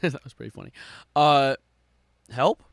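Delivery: normal talking voice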